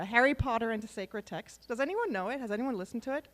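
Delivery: usual speaking voice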